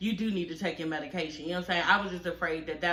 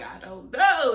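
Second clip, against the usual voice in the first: mock crying; 4 semitones higher, 5 dB louder, slower than usual. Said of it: "And I was like".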